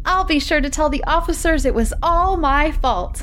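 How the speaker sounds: sly, sweet-talking milkman. Acted. Milkman sweet-talking Michael's wife, high put-on voice.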